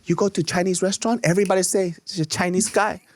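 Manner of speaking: light mock accent, waitress teasing him; imitating a Chinese accent. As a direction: talk like the teasing old waitress. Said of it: "She goes".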